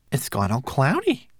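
high little manservant voice; fussy tiny homunculus. Acted. Twigleg speaks.

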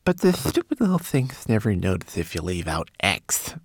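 sleepy little character voice. A narrator's reading. Twigleg finishes his tip about elves, sleepy voice. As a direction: tail off as if nodding off. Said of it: "remembered Twigleg sleepily".

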